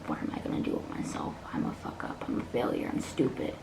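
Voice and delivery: hushed, low, defeated inner voice; despairing self-talk. Retold or imitated. Remembering failing school, telling herself in hushed despair. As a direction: whisper the insults to yourself. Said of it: "I would tell myself that all the time".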